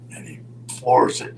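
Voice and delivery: ordinary delivery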